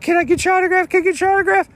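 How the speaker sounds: put-on very high, squealing kid's plea; excited little girl. A little girl begging the guitar player for an autograph, very high and excited. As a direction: beg like a starstruck child.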